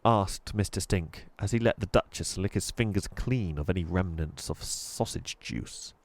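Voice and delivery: plain reading voice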